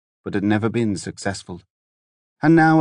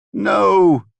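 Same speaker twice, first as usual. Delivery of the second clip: high, louder anguished wail; 9 semitones higher, 6 dB louder than usual. A narrator's reading. The daughter refuses Devon's words, wailing high and loud. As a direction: let out a raw wailing no. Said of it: "she wailed".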